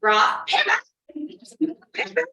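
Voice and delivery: drawn-out high, loud mock yell of pivot, very slow; yelling pivot like the Friends couch scene, played for laughs. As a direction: ham up the sitcom pivot scene, stretching it out. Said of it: "that episode on Friends".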